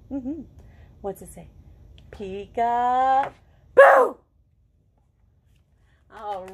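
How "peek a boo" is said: loud, slow, stretched peek-a-boo; drawn-out peekaboo. Acted. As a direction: draw out the big peekaboo reveal. Book's closing line to kids.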